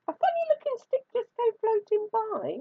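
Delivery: high innocent little voice; squeaky spider voice. A narrator's reading. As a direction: play innocent, high and thin. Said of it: "Anansi said".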